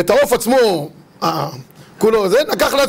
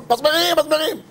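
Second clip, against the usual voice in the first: mock cry of nails; 6 semitones higher than usual. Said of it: "הוא היה צועק".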